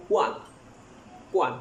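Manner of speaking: normal talking voice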